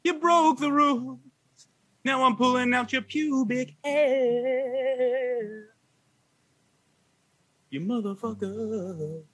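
put-on slurred, slow, rambling drunk threats; belligerent drunk. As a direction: drunk man picking a fight, slurring. Belligerent ranting comedy bit.

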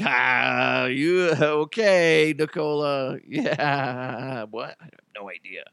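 slow, puzzled ref shrugging it off; confused ref. Pretend. Refs not understanding Serbian swearing, slow puzzled mock voice. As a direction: drawl it out like a baffled ref who doesn't get the insult.

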